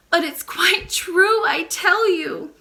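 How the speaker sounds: put-on high, slow, pleading child near tears; tearful plea. Princess insists to the nurse, tearful and high. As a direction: insist through tears, slowly. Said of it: "The princess burst into tears".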